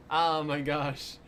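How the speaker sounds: usual speaking voice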